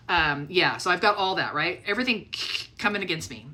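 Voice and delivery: ordinary talk, nothing marked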